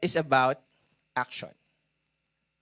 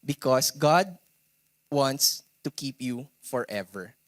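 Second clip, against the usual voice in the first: ordinary talk, nothing marked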